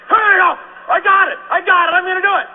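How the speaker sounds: high, loud, eager shout; excited shouting. Retold or imitated. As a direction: shout it, all fired up. Retold pumped-up recruit.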